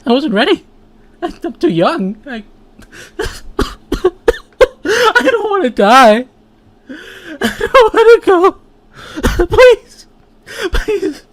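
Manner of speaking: high, giggling fake death plea; laughing mock plea. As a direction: beg for life while cracking up. Dying character laughing and pleading.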